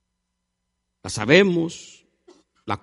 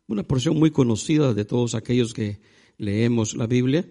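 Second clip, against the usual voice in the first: usual speaking voice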